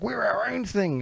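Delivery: high, soft put-on protest; mock protest. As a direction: imitate someone insisting, in a small high voice. Mocking Australians' claim to be different.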